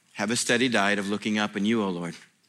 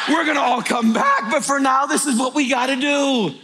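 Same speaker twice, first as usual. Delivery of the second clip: high, loud, driving sermon; full-force preaching; 13 semitones higher, 5 dB louder than usual. Acted. Pastor firing up the congregation, loud preaching. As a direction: preach it out to the crowd.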